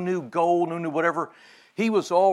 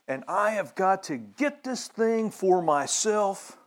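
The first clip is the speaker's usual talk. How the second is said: slower, higher, a hint of a grasping self-seeker; voicing worldly desire; 6 semitones higher, 3 dB louder, slower than usual. Preacher voicing a selfish man's craving, lightly acted. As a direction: say it as the man who must have it.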